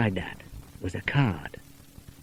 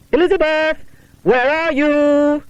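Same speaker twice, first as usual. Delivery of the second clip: high, loud, drawn-out call; 5 dB louder than usual. Acted. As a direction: call into the empty house. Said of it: "called Barbara".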